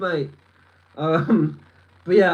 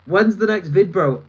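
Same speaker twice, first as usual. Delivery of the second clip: normal talking voice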